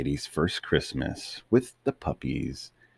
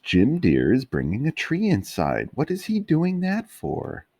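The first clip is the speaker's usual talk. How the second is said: high, eager pup voice; excited puppy; 11 semitones higher, 4 dB louder than usual. Acted. Puppies telling Mama about the tree, high eager voice. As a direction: ask high and bouncy.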